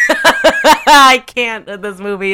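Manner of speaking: laughing hard, high and loud; helpless laughter bursting into the words; exasperated, laughing outburst; cracking up. Real, not acted. Cracking up at the movie.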